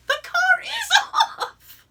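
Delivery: high, slow, laughing shout; laughing exasperation. Real, not acted. Insisting to the clerk the car is off, laughing shout. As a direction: burst out in disbelief through a laugh.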